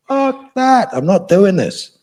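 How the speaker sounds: high, fierce refusal, slowing on the second line; defiant refusal. Retold or imitated. Defying his own brain as he dies, furious and high. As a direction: spit back a furious no.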